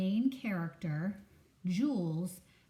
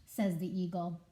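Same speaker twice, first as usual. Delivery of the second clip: usual speaking voice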